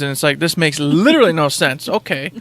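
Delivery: ordinary delivery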